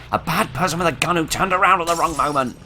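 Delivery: high, loud alarmed yell; panicked shout. Real, not acted. Gamer yelling as an armed enemy turns on him. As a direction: shout it as the enemy spins round.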